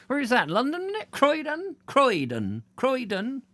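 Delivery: very high, querying comic voice; high-pitched puzzled character; confused local. Pretend. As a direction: squeak out each baffled question. Acted confused local asking.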